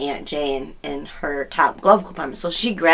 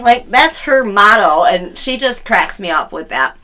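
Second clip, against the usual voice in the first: ordinary talk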